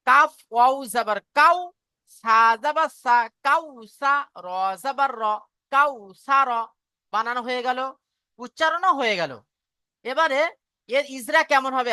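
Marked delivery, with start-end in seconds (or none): 0.0-6.7 each syllable drawn out slowly; slow teacher demonstration of spelling; slow spelling demo. Shown as a demonstration. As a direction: spell it out piece by piece for the class. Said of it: "আমি একবার বলে দিচ্ছি, দেখেন".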